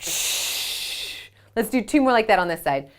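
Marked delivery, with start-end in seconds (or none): 0.0-1.3 soft whispered shh of water spraying; spray sound. Shown as a demonstration. Mom shows the elephant spraying water, a soft shh. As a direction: hiss out the trunk's spray. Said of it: "spray it out".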